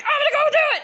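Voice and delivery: put-on high, loud kid's cry; squealed, retold excitement; imitating excited boy. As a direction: blurt it like an eager little nephew. Imitates excited nephew running off.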